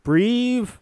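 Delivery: loud, high "Breathe."; commanding breath cue; coaching command. Acted. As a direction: call the breath out firmly like a coach. Singing coach instructs Ruby.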